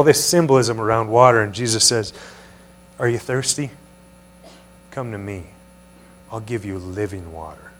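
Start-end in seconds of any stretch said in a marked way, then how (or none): 3.0-7.7 soft, hushed invitation with pauses; gentle invitation. Acted. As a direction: offer it quietly and tenderly, dropping lower. Voicing Jesus' invitation to the crowd.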